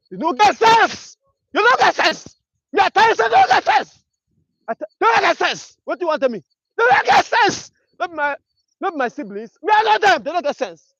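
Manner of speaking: loud, high furious rant; angry shouting. Real, not acted. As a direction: yell it out in outrage. Ranting about rich people.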